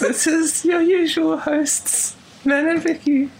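slow, very high podcast intro; high, plaintive mock-host voice; creepy falsetto. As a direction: introduce the hosts in a wispy pop-singer falsetto. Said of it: "You sound like [name]".

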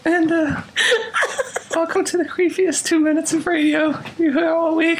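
high, lonely falsetto plea; mock-forlorn, high and eerie; creepy voice. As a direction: play a creepy little voice begging for company. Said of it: "creepy voice for, like, three minutes".

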